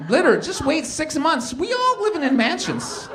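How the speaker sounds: loud, high, slow sly talk; scheming hustler. Retold or imitated. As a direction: eye the pool and drawl out the scheme. Eyeing owner's mansion.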